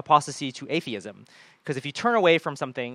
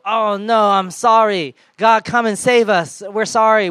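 louder, higher, slow begging cry; desperate plea; 5 semitones higher, 8 dB louder, slower than usual. Retold or imitated. The people crying out to God in their suffering, frantic pleading. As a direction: beg God to come and save you.

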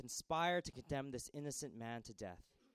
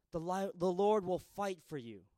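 higher, louder promise; proclaiming "The Lord will fight for you" louder each time; rising proclamation; 5 semitones higher, 7 dB louder than usual. Shown as a demonstration. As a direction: build it to a cry.

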